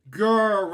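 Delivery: loud playful lion growl. Acted. Reading the lion story aloud, a loud playful growl.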